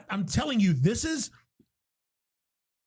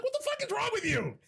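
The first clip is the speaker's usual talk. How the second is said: high-pitched mock disbelief; imitating his own outraged disbelief; incredulous; 5 dB quieter than usual. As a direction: squeak it out, appalled at your friend. Shocked retort to friend.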